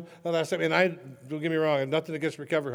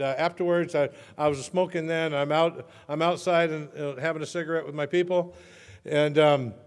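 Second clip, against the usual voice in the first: ordinary talk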